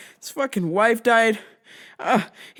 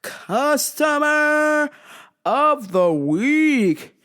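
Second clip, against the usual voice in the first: slow, drawn-out announcer title; segment announcer; 5 semitones higher, much slower than usual. Acted. Introducing the weekly customer story, slow announcer style. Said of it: "segment title".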